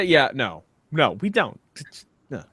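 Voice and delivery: ordinary talk, nothing marked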